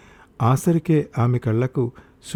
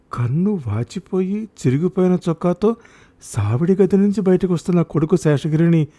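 ordinary delivery

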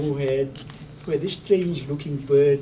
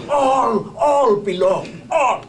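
ALL hit hard and loud; ringing emphatic all; stress on all; 4 semitones higher, 6 dB louder, slower than usual. Real, not acted. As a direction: hammer the word all.

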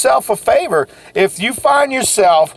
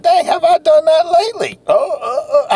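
mock whining; 9 semitones higher than usual. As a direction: whine like a sorry loser. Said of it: "I heard somebody whining".

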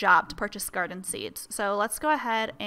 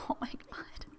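whisper; 12 dB quieter than usual. Real, not acted. Overwhelmed by cute rabbit.

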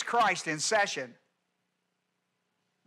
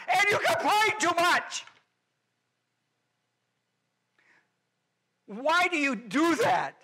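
much higher, louder exasperated cry; exasperated scolding; 10 semitones higher, 5 dB louder than usual. Acted. Pastor scolding the congregation for complaining, exasperated and high. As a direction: throw up your hands at them.

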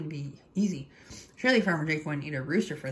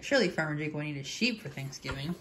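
usual speaking voice

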